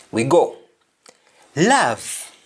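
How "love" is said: "Love" said with an open "ah" vowel, lav; English-style "Love", vowel like "ah"; English pronunciation. Shown as a demonstration.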